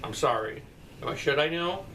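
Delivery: normal talking voice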